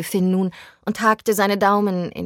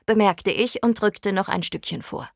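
plain reading voice

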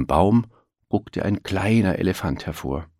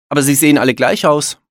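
higher, louder and quick; Willi's voice; 8 semitones higher, 6 dB louder, faster than usual. A narrator's reading. Willi complains to Elmar that all look alike, higher and fast. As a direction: rattle it off as a lively young elephant.

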